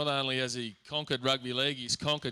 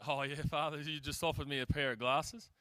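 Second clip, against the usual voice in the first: ordinary talk, nothing marked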